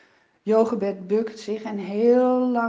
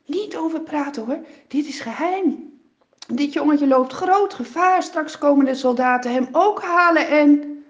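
louder, higher, pressing parental warning; urgent warning; 6 semitones higher, 9 dB louder than usual. Acted. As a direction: parents frightened for their son, urging secrecy. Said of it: "zeggen de vader en moeder".

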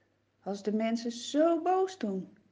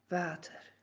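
hushed; 6 semitones lower, 9 dB quieter than usual. Acted. Revealing the miracle softly.

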